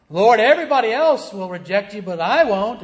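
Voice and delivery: proud vow, lightly hammed-up; boastful Peter. Preacher voices Peter boasting to the Lord, loud and brash.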